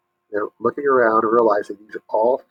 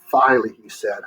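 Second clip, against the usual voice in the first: plain reading voice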